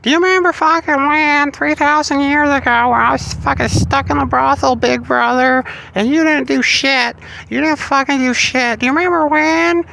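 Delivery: high, squeaky nagging goddess voice; nagging Aphrodite. Retold or imitated. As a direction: whine it up high like a scorned sister. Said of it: "three thousand year old remember when".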